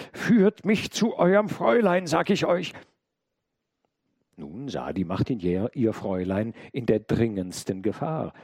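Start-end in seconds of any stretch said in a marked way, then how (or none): 0.1-2.7 high, loud, forceful demand; wild cry. A narrator's reading. Intruder demands the maid take him to her mistress, wild cry. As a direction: call out wildly, pitched well up. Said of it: "rief noch wilder als zuvor".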